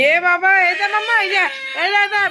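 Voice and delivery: calm her down and point her to mummy. Real, not acted. Hushing crying baby, pointing at mother.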